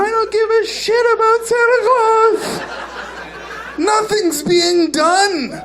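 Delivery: very high sing-song tantrum, slow at the end; whiny child. Retold or imitated. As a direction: wail it like a spoiled toddler. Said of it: "this little thing just starts going".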